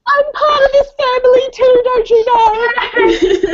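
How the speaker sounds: put-on high, loud little-kid voice breaking into laughter; hammed-up indignant toddler; laughing toddler voice. Friend joins voicing toddler.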